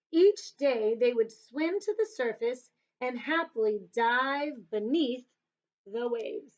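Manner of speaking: put-on lively, lilting storybook read to kids; reading to children. Teacher reading a picture book to children, lilting and lively. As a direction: paint the sea for little listeners, savouring each image.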